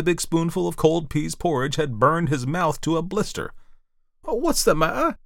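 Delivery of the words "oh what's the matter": high-pitched startled woman's voice; much higher, concerned character voice; alarmed woman. A narrator's reading. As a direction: ask in a high, worried flutter. Woman alarmed by man's yell.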